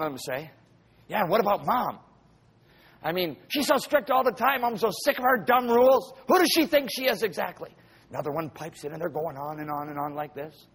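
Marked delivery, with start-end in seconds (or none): 1.1-7.7 put-on higher whiny kid griping; a spoiled teen sneering about mom's rules; complaining teen. Preacher voices second child.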